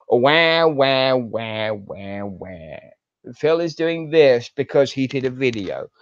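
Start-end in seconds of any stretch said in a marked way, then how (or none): 0.1-2.8 mock baby wail. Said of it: "a whiny, crying little bitch".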